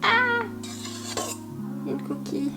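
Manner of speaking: usual speaking voice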